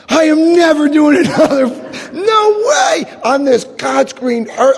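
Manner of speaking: indignant outburst. Retold or imitated. Comic storyteller vowing never to do this again, indignant high outburst. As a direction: swear it off at the top of your range.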